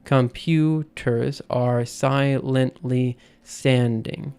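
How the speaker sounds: slow, each stress pressed; drawn-out metrical reading; scanning the beat. Shown as a demonstration. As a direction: sound out the meter.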